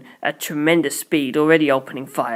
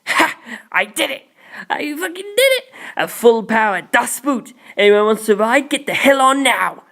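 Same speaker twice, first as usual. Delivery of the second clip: loud, high triumphant yelling; excited shouting; 7 semitones higher, 3 dB louder than usual. A narrator's reading. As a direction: crow it out at the top of your lungs. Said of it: "Naranja started shouting".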